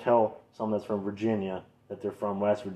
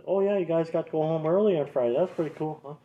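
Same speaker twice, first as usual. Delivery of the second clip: lightly higher teasing coworker; mocking office worker; 8 semitones higher, 3 dB louder than usual. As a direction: mimic a clueless office coworker. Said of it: "the office people".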